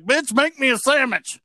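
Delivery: high-pitched bossy goat demand; mock-macho goat, high voice; cocky goat.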